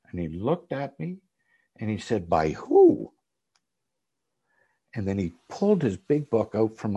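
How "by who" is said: a sudden louder, deadpan challenge; pointed comeback. Retold or imitated. Old-timer Joe challenging the young newcomer, louder pointed retort. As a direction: land it like a gotcha, punching it louder.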